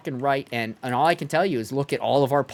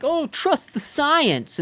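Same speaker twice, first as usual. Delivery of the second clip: much higher, slow, dreamy parody; mocking quote; 10 semitones higher, much slower than usual. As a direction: drag it out mockingly. Mocking people who trust science.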